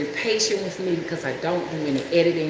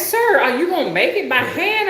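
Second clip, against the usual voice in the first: mock incredulous; 8 semitones higher than usual. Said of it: "And I'm like".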